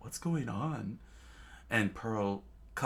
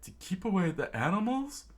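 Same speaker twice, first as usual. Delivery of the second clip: high, incredulous questioning; high-pitched baffled query; chef crew's question; 11 semitones higher, faster than usual. A narrator's reading. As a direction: ask it high, swooping upward. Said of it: "And the chef crew said".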